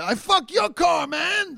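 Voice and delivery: high, far-off mock yell; angry yell. Angry stranger yelling at the car, mock furious yell. Angry driver quoted in story.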